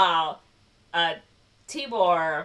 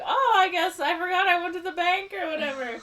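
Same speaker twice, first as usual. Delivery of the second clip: very high, louder put-on voice; feigned nonchalance; 13 semitones higher, 5 dB louder than usual. She covers for the money in her purse, feigned nonchalance. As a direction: cover up with a high, fake-casual excuse.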